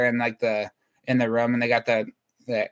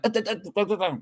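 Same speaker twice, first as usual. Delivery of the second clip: repeated high stuck uh; imitating own stutter; stutter demo; 7 semitones higher, much faster than usual. Shown as a demonstration. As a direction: show a stutter on the uh sounds. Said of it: "I've always been like".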